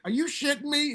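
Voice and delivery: high, incredulous squeak of shock; retold disbelief. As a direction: act floored by the news, pitch jumping up. Shocked at George's answer.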